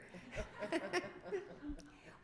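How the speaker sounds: high, airy laugh. Real, not acted. Preacher teasing the shy congregation, laughing.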